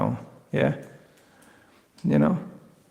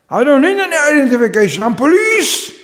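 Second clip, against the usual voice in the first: high, loud mock-indignant protest; indignant policeman; 10 semitones higher, 5 dB louder than usual. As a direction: play the offended young cop, pitched up.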